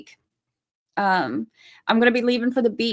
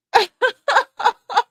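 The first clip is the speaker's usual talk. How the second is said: high-pitched run of real laughter; helpless giggling; 12 semitones higher than usual. Real, not acted. Streamer still cracking up at a comment, giggling helplessly.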